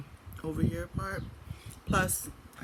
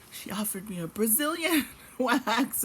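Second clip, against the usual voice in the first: higher, slowed words breaking into laughter; amused laughing; 6 semitones higher, 4 dB louder than usual. Real, not acted. Telling viewers of a friend's wax offer, laughing. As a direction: laugh through the absurd detail.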